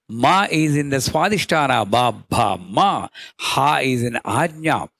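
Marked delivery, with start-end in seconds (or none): none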